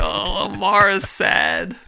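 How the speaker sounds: slow, high mock-sad voice. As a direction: drag it out like pouting for a child. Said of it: "I'm like".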